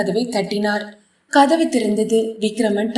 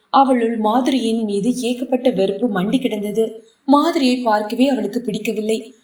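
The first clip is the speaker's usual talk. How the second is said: ordinary delivery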